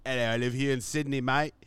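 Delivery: broad mock Australian accent; Australian devil. Devil says he lives in Sydney, mock Australian accent. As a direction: put on a chummy Australian devil. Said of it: "like, an Australian".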